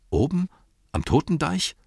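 high-pitched, uneasy question; worried disbelief. Acted. Innkeeper questions wizard about Totendeich, worried disbelief. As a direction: rise high in wary doubt. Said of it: "schaute noch bedenklicher drein".

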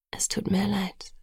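soft, breath-laden regret; sighing apology. A narrator's reading. Boyfriend refuses to condemn her, sighing softly. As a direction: let it out like a sigh. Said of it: "er seufzte".

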